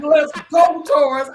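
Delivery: high, loud imitation of a brother rooting; imitated rallying shout; brother's cheer. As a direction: yell encouragement like family in the crowd. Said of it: "And then hearing Mike Torres' brother".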